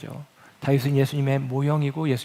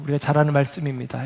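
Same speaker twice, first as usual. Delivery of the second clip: ordinary talk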